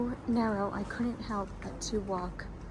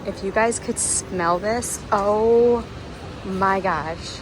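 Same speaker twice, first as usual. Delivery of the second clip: loud, delighted oh my gosh; savoring a smell; 8 dB louder, slower than usual. Real, not acted. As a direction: swoon over the scent. Smelling the shop.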